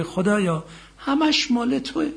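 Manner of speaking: high, slow tender plea to God; sincere surrender. Acted. Believer surrendering to God, slow and tender. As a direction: give it all up gently. Voicing the truly patient man.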